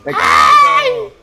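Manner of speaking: high, drawn-out scream of panic; panicked scream. Real, not acted. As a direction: yell it as you fall. Falling off in game.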